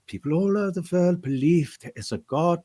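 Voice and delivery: put-on high, earnest German-accented preacher line; Nightcrawler's German accent. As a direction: deliver it like a devout German mutant. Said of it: "coming to the end of it".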